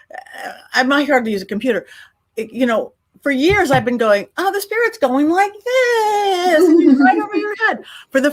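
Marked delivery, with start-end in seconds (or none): 4.4-7.8 excited retelling. Retold or imitated. Excited retold demo.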